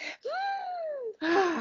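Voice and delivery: long high sighing breath out; demo deep sigh. Shown as a demonstration. Showing her cohost how she takes a deep breath, long sigh. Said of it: "This is how I take a deep breath".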